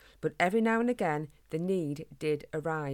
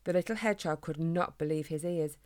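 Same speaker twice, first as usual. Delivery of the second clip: plain reading voice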